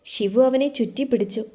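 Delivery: ordinary delivery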